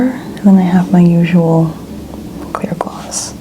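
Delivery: usual speaking voice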